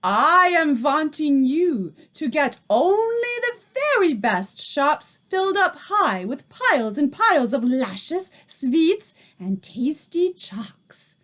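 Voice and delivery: lightly voiced witch boss, ending in a whisper; accented witch. A narrator's reading. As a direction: lay out the plan like a greedy tyrant. Holds the witch voice.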